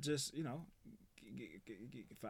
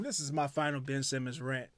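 announcer intro; 5 semitones lower, 8 dB quieter than usual. Acted. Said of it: "Once again, you're on the bench".

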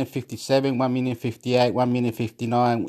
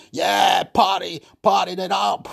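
higher, hyped party shout; a hint of a hyped rapper; 7 semitones higher than usual. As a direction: ham up a hype man. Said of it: "He's going".

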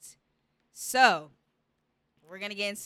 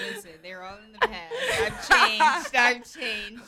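laughing; 8 semitones higher, 9 dB louder than usual. Real, not acted. Playful denial while laughing.

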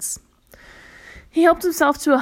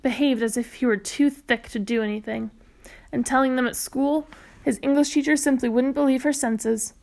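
usual speaking voice

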